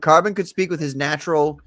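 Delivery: ordinary talk, nothing marked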